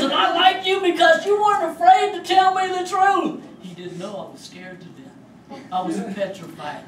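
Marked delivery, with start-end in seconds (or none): 0.0-3.4 big shout. Retold or imitated. Inmate jumps up and points at Chuck, a booming shout. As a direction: bellow it out, stretching every word.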